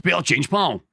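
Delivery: demonstrating a Glaswegian beggar's line; short Glaswegian catchphrase, vowels squeezed; Glaswegian accent. Shown as a demonstration. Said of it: "lock you into the Glaswegian accent".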